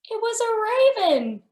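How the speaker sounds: high, bright reveal of the raven; high excited storytime reveal. Real, not acted. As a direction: announce the raven with delight.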